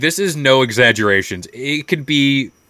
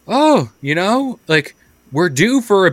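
chirpy neighbor voice; 8 semitones higher than usual. Retold or imitated. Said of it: "they're like".

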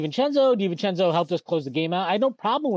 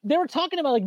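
usual speaking voice

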